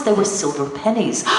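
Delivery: usual speaking voice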